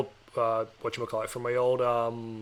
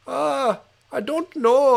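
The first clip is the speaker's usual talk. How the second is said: put-on higher-pitched Indian-accented telemarketer; imitating a caller's Indian accent, high-voiced; 10 semitones higher, much slower than usual. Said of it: "I'm doing an Indian accent".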